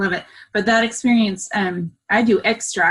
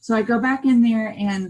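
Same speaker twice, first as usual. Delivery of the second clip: ordinary delivery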